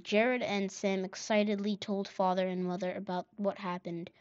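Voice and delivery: ordinary delivery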